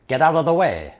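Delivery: mildly forceful warning, teacher acting it out; acted command. Threat translated with some force.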